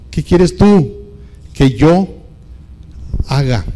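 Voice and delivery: fervent preacher's repeat; forceful preaching. Shown as a demonstration. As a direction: push the question out hard. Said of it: "repeated question, now forceful".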